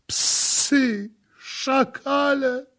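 very high, loud, drawn-out wail; agonized moaning. A narrator's reading. Victim under the floor cursing the priests, agonized wail. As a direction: hurl the insults in a high, tormented cry. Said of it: "jęczał głos".

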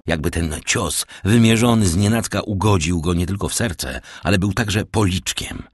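ordinary delivery

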